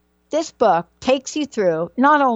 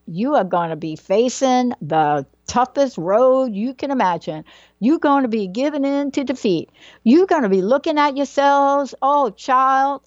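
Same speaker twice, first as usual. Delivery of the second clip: Southern mom voice, pitch lifting as she goes; higher, animated Southern-accented mom; mom's Southern accent; 4 semitones higher than usual. Retold or imitated. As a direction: imitate a Southern mother going on at her girls. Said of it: "in a little Southern accent".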